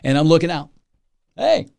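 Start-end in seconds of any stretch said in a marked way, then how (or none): 1.4-1.7 startled hey. Pretend. Spotting tidal wave, calling out.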